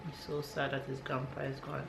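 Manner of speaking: ordinary talk, nothing marked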